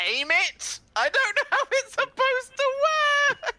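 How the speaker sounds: very high, helpless squeal; flustered outburst. Real, not acted. Player improvising a bean spell, flustered high squeal. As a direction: voice shoots up in clueless exasperation.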